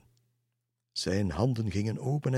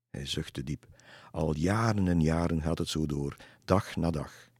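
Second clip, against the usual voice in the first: usual speaking voice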